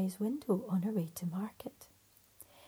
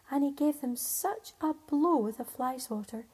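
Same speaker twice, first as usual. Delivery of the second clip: plain reading voice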